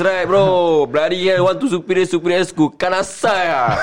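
shouting in anger; angry. Real, not acted. Ranting at people who bully others.

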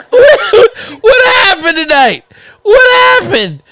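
high loud laughing yell; cracking up and shouting. Real, not acted. Playful shouting after laughter.

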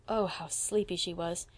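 ordinary delivery, nothing marked

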